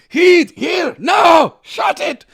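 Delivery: high, loud Scottish shout; Scottish yelling dad. Retold or imitated. Dad quoting the movie to the kids, loud Scottish shout. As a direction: bellow it like the Scottish father. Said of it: "my dad would just be quoting that".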